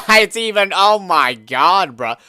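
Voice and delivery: loud, high laughter through the words; laughing out loud. Real, not acted. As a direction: burst out laughing in disbelief, slowly. Reacting to the currency converter.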